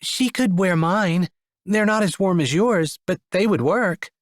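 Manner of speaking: a lightly voiced gentle woman. A narrator's reading. Krista offering boots.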